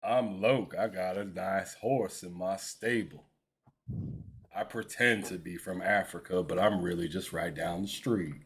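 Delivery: low, drawling Texan impression; mock Texan accent. Impersonating his friend to tease him, low mock Texan voice. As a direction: drop your pitch and play a hokey Texan. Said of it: "don't impersonate me with that Texas voice".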